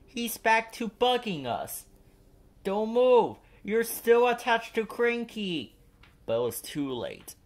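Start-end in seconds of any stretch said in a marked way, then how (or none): 0.1-1.8 exasperated little engine. A narrator's reading. Percy grumbling. 2.6-5.8 urgent warning. A narrator's reading. Warning shout.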